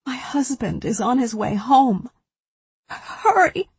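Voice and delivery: high, frantic plea for help; desperate cry. A narrator's reading. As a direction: cry out to the phone in panic. Heroine shouting into phone.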